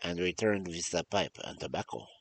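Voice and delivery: storytelling in a put-on French-German accent; muddled French-German accent. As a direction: read the tale with a hammy continental accent, French sliding into German. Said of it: "French accent when this is supposed to be German".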